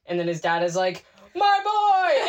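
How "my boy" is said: high, loud grieving cry of a father; anguished dad wail. Retold or imitated. A father howling over his son's body, loud and high. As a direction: howl it like a father finding his dead son.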